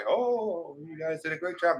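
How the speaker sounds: high-pitched mock praise; imagined gushing fan. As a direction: imitate an admirer gushing from a high start. Voices an imagined viewer's mistaken praise.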